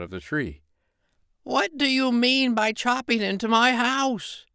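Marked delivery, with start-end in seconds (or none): 1.4-4.5 high, slow fairy voice, indignant; a tiny old fairy scolding the woodcutter; high-pitched angry fairy cry; angry little fairy. Acted. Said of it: "he cried".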